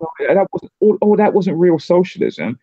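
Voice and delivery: a hint of a dismissive socialist; mocking imitation. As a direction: mimic them with a whine. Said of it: "people go".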